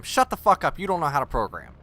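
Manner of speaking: mock-furious rant at commenters; angry rant. Real, not acted. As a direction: tell the critics off hard. Ranting back at critics.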